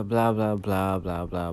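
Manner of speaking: usual speaking voice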